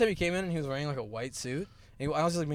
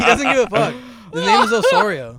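outraged; 8 semitones higher, 13 dB louder than usual. Real, not acted. Outraged about teacher.

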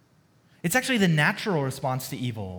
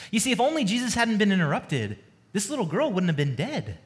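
normal talking voice

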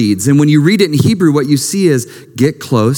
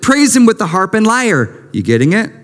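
raised, ringing scripture reading; psalm reading; 6 semitones higher than usual. Acted. Preacher reading Psalm 150 to the congregation, high and ringing. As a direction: proclaim each line of praise.

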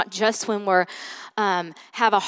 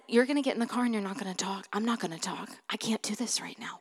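soft, restrained mad voice; retold quiet anger; 9 dB quieter, faster than usual. Mad mom ordering daughter into the car, quietly stern. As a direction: lay down the law under the breath. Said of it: "And I leave mad".

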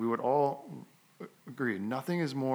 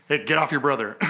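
louder, sharp mock telling-off; retold angry scolding; 3 semitones higher, 7 dB louder, faster than usual. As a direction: snap at the kid, lightly re-enacted. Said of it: "that spirit of anger".

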